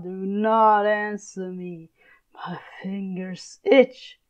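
slow, menacing whisper; seething whisper. Acted. Father hissing his threat at daughter, seething whisper. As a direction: hiss it through clenched rage.

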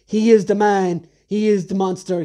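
normal talking voice

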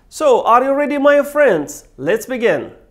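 high, loud rallying question; excited call to start. Real, not acted. Teacher hyping up his students, eager and loud. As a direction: pump up the viewers before the lesson.

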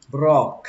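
"brock" said up, as the stressed syllable. Shown as a demonstration. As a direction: lift the voice on "brock". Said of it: "Các bạn lên giọng".

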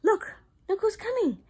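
ordinary talk

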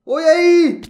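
high, loud cry of dismay; dismayed shout. Real, not acted. Gamer falling from the parkour course, a loud high cry. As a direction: yelp as it all goes wrong.

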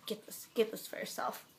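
quiet unvoiced whisper. Real, not acted. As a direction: whisper the recommendation. Whispered recommendation.

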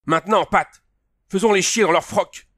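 high, loud rallying yell; fired-up commander's cry; battle shout. A narrator's reading. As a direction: spur the gunner to fire. Battle order to the gunner.

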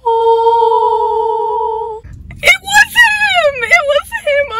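high, crying shriek of joy; real excited scream. Real, not acted. Fan finding the wanted clown figure, shrieking with joy. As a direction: scream it out, thrilled to bits. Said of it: "I'm, like, screaming".

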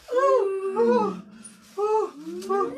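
high, loud panicked oh's; frightened gasps. Acted. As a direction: gasp in panic. Scrooge shaken after fright.

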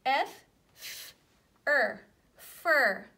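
"F, f, er, fur" sounded out one piece at a time, loud on "er"; loud phonics blending; sound blending demo. Shown as a demonstration. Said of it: "we don't hear the U".

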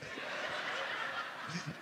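airless wheezing laugh; breathless silent laugh. Real, not acted. Preacher cracking up at the goat joke, voiceless wheeze. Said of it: "I sound like a goat".

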